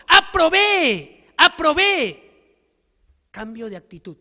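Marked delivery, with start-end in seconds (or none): 0.1-2.5 loud, high, stretched-out cry of triumph; joyful shout. Retold or imitated. Boy shouting that he passed, loud and drawn out. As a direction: shout it slowly, like a kid who just passed. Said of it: "El niño gritó".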